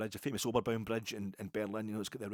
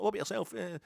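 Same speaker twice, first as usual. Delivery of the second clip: much higher, louder, teasing tone; mock cheeky question; 12 semitones higher, 5 dB louder than usual. Asking the kebab man his team, high and teasing. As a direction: lob the question up high for a laugh. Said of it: "just for a laugh, I said".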